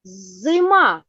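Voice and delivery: Зима with the opening З held long; first sound drawn out. Shown as a demonstration. Teacher cueing the first sound, З stretched in Зима. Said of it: "Назвіть перший звук".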